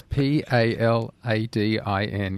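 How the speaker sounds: spelled out slowly, letter by letter; slow spelling. Shown as a demonstration. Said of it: "I'll just say that slowly".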